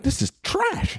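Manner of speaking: a high, soft scoff of disgust; high mock-disgusted dismissal; disgusted verdict. Judging the bad comic videos.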